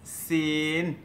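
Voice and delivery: "সিন" drawn out with a plain s; clear s sound. Shown as a demonstration. Teacher asks learners to note closely, drawn-out s-sound "সিন". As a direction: hold a long vowel in "সিন" after a clear s. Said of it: "ভালো করে লক্ষ্য করেন".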